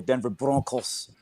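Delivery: Broncos said with exaggerated Spanish vowels; over-enunciate Broncos as if it were Spanish; over-enunciated Spanish. Mocking Americans in a comedy sketch, over-enunciated Broncos. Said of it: "a lot of Americans really exaggerate that".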